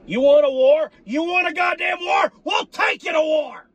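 rising, shouted challenge to rival teams; war cry. Real, not acted. Fan yelling at rival teams, fired-up war cry. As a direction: yell it like a battle call.

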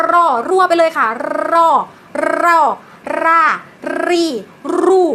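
รอ รอ รอ รา รี รู with a strong rolled R; trilled R drill. Shown as a demonstration. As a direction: trill every R, one syllable at a time.

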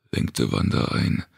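ordinary delivery, nothing marked

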